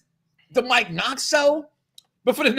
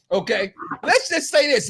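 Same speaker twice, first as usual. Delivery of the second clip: usual speaking voice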